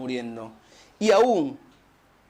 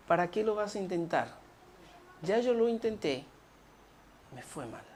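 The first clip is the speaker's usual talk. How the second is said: defeated quiet quote; 10 dB quieter, faster than usual. Retold or imitated. Voicing a discouraged person.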